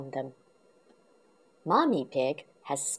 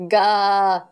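dragon roar; 2 semitones higher, 9 dB louder than usual. Acted. Dragon roars at George.

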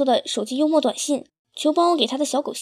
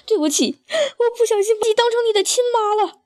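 high, tearful stepmother voice; 7 semitones higher than usual. A narrator's reading. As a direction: speak as a stepmother wiping away tears, much higher. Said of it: "她一边擦脸一边说".

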